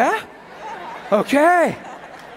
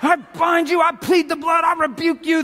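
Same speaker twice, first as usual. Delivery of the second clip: hammed-up shouted binding of the devil; shouted rebuke; 7 semitones higher than usual. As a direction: bark it like a panicked prayer warrior. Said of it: "That's what we're taught".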